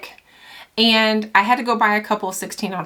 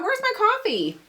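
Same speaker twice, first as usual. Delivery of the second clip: much higher, playful squeak; high squeaky voice; 13 semitones higher than usual. Real, not acted. Looking for her coffee again.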